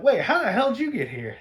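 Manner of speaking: high, loud indignant question; startled anger. Retold or imitated. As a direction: demand it in shock. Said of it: "the Queen's like".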